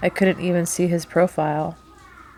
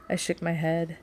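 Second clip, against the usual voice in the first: ordinary delivery, nothing marked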